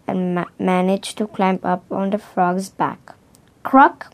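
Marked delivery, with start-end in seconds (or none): 3.6-4.1 high, loud, short croak; frog croak. A narrator's reading. Frog answering from the mud, croaking. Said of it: "went the frog".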